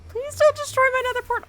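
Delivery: high, fast, loud begging; panicked plea. Real, not acted. Gamer begging for the nether portal's safety, panicked. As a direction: plead urgently in a high rush.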